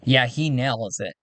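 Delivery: ordinary delivery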